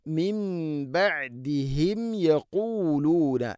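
slow, chanted Quran verse; slow recitation. Shown as a demonstration. Teacher reciting the verse for the class, chanted. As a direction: chant it slowly and clearly. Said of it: "recites to students".

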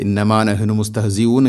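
"ഇന്നമാ നഹ്നു മുസ്തഹ്സിഊൻ" rattled off without holds or throat h; mistaken recitation. Shown as a demonstration. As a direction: demonstrate careless, unchanted "ഇന്നമാ നഹ്നു മുസ്തഹ്സിഊൻ". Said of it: "ഇങ്ങനെ ഓതരുത്". ("ഇന്നമാ നഹ്നു മുസ്തഹ്സിഊൻ" മണിക്കാതെ തെറ്റായി)

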